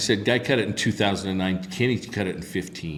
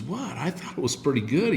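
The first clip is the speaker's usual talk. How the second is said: taken aback; 6 semitones higher than usual. Retold or imitated. Songwriter to Ray who stopped his song, taken aback. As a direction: imitate a songwriter surprised at being stopped.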